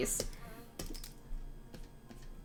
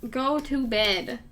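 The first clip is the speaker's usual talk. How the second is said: normal talking voice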